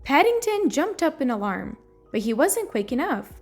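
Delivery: ordinary delivery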